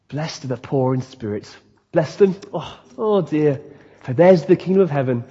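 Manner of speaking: soft, airy mock-pious Jesus; pithy pious saying. Preacher mimicking the picture-book Jesus in a bathrobe, mock pious. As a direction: send up a sweetly feeble preacher.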